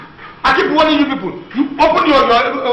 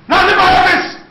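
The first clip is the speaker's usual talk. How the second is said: higher, firm dismissal; angry order; 5 semitones higher, 3 dB louder than usual. Acted. Boss orders the staff member out, angry. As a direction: throw her out.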